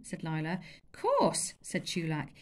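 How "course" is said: high, offhand; cocky boy. Acted. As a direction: shrug it off. Chulak offhand.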